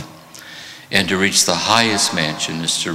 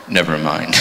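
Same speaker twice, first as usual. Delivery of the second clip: resigned never mind; 4 semitones lower than usual. Retold or imitated. Punchline, teacher gives up.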